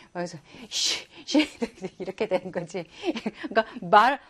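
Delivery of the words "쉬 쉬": hissed puff of air, "shh"; imitated angry huffing. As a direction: mimic air huffing out of the nostrils. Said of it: "콧구멍에서 바람만 씩씩 불었어". (씩씩대는 콧바람 흉내)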